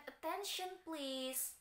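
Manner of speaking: Attention please stretched very slowly; teacher modelling a phrase; very slow demo. Shown as a demonstration. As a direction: spell it out slowly for students. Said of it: "Jadi menggunakan".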